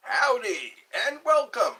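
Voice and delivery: loud, high, bright hello; cheery greeting. Acted. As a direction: greet the viewers big.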